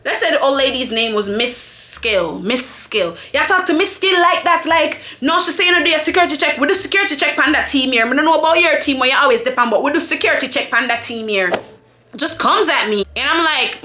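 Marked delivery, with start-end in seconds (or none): 3.3-11.5 imitating a quick patois telling-off; coworker's indignant Jamaican patois. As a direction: rattle off the scolding in Jamaican patois. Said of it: "I'ma say this in patois".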